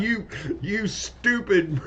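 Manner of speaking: high, drawn-out goblin jeer; goblin insult. Acted. Goblin insulting the players, high jeering voice. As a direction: hurl a goblin insult, half laughing. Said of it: "Or just hurl insults".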